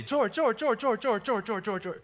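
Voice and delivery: high, loud repeated calls fading at the end; old ladies calling. Retold or imitated. Ladies calling the worker nonstop, high and loud. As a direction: call George over and over, high then easing down.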